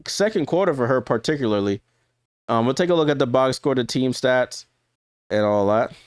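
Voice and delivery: normal talking voice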